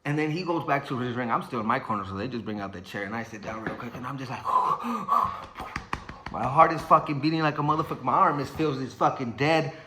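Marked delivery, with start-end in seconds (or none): 4.5-6.3 acted heavy puffs of breath; exhausted panting. Boxer on the corner stool after round one, winded panting. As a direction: blow out air as if winded.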